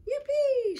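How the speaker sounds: high, loud cheer; excited shout. A narrator's reading. Michael hears there will be no rules, excited shout. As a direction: whoop it out high with a falling tail. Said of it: "shouted Michael".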